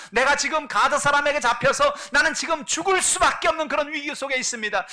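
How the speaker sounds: high, loud impassioned sermon; forceful preaching. Acted. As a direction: preach with rising urgency. (높고 크게 열정적으로 설교)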